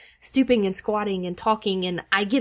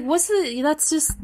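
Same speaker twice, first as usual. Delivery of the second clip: ordinary talk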